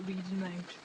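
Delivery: ordinary talk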